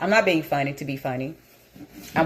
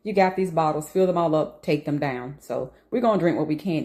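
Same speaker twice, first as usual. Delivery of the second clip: normal talking voice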